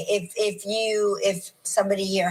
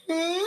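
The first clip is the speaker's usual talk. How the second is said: high, questioning dog whimper; little high dog noise; imitated dog whine; 11 semitones higher than usual. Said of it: "she's like".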